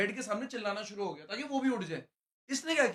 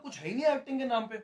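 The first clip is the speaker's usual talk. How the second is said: ordinary delivery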